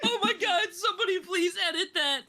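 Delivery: high, overwrought plea; excited begging. Real, not acted. Host begging artists for an edit, excited begging. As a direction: wail it, half laughing.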